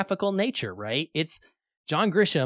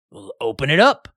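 loud, pushy order to open it; loud sheriff order; 8 dB louder than usual. Retold or imitated. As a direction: bark it like a small-town sheriff. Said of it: "The sheriff's like".

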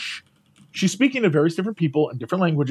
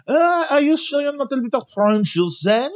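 imitated attendant; 9 semitones higher than usual. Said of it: "she would have been like".

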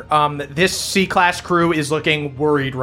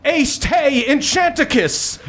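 high, drawn-out spell casting; boy wizard incantation; 12 semitones higher than usual. Acted. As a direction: cast it slowly in a high boyish voice. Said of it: "the boy wizard appears".

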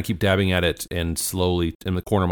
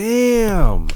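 surprised; 14 semitones higher, 7 dB louder than usual. Real, not acted. Player impressed by roll.

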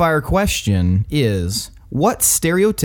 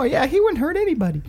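much higher, mock sweet sarcasm; sarcastic; 12 semitones higher than usual. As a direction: say it with fake innocence.

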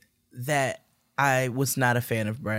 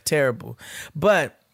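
ordinary talk, nothing marked

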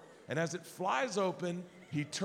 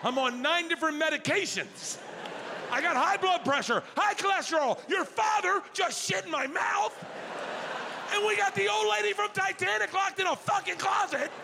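building outraged rant; 9 semitones higher than usual. Retold or imitated. Grandpa to granddaughters about his heart rate, building to a high shout. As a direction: pile up every grievance until it bursts.

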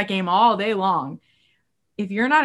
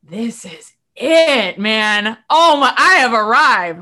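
elated; 3 semitones higher, 9 dB louder, slower than usual. Acted. Joyful arrival quote.

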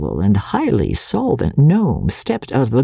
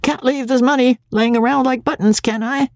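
high-pitched preoccupied father; half talk, half count, mind on the money; distracted high-voiced reply; absent muttering; 10 semitones higher than usual. A narrator's reading. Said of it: "Andy muttered absently".

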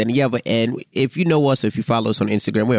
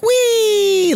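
very high, playful squeal; mock giddy whee; 4 dB louder than usual. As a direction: imitate someone gleefully floating away. Imitating a giddy, high patient.

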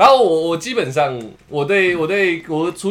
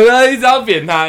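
ordinary talk

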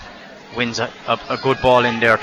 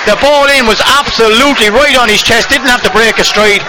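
high, loud, breathless commentary; excited; 8 semitones higher, 4 dB louder than usual. Real, not acted. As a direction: still buzzing after the goal. Goal replay in commentary.

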